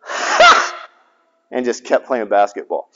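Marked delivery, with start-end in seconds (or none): 0.0-0.9 sharp breathy mock scoff, much higher; friend's one-note scoff, unvoiced; scoffing laugh. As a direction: huff a single dismissive laugh. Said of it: "he goes".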